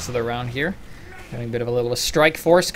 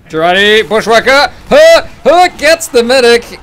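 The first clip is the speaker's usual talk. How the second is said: high, loud hype call; excited shout; 11 semitones higher, 16 dB louder than usual. Real, not acted. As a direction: yell the play out. Caster hype on a pick.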